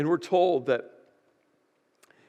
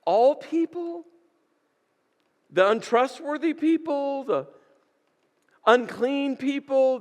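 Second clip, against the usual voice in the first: higher, disbelieving questions; incredulous shepherds; 8 semitones higher than usual. Retold or imitated. Shepherds doubting the angel means them, incredulous and high. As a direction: ask it like you can't believe you're included. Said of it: "I'm sure they looked at each other and like".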